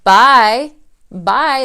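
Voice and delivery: loud, breezy dismissal; dismissive bye. Retold or imitated. Seller rejecting a contingent offer, dismissive. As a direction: wave them off cheerfully. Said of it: "I would be like".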